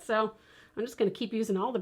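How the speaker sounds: ordinary talk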